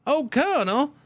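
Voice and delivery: high mock heavy accent on "Colonel, oh, colonel". Shown as a demonstration. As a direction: lightly ham up a heavy accent. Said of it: "say it with a heavy accent".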